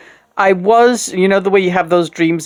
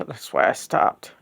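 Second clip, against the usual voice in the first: soft unvoiced repeat to herself; whispered echo; 8 dB quieter than usual. Real, not acted. Repeating her own words to herself in a whisper. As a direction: whisper it back to yourself. Echoed line, second take whispered.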